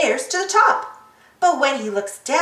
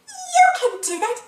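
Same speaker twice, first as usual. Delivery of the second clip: put-on much higher little girl voice; Peppa voice; 11 semitones higher, faster than usual. Peppa encourages Mummy at the stall, in a much higher child voice. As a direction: cheer Mummy on as a little piggy. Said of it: "says Peppa".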